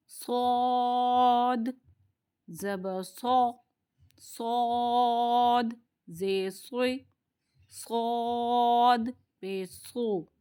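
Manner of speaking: सौद stretched, full and heavy; heavy saud drill. Shown as a demonstration. Teacher drills heavy सौद syllables slowly. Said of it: "इसको मोटा पढ़ेंगे".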